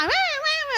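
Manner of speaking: high, soft mock baby wail; imitating baby cry. Mother mimics her newborn's crying, high and soft. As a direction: squeak out a baby's cry. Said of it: "começava a chorar e berrar".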